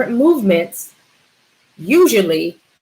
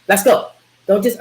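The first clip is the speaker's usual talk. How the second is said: ordinary talk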